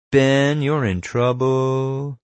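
high, slow singsong taunt. A narrator's reading. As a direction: draw it out with gloating glee. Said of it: "he taunted".

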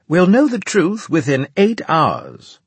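high, slow, loud officer voice; Winslade concluding briefing. A narrator's reading. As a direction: announce it high and drawn out.

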